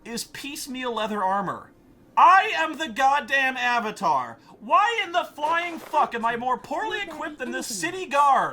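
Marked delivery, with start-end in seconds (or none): 2.2-8.5 ranting. Real, not acted. Ranting at the game.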